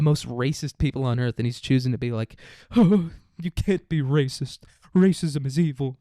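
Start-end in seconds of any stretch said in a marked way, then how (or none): 2.8-6.0 higher, slow and sanctimonious; mock preachy. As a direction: lecture like a prissy hall monitor. Parodying Portnoy's moralizing.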